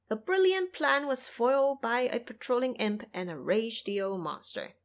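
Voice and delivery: usual speaking voice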